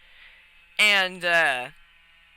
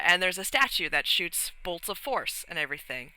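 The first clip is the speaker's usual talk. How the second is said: ordinary talk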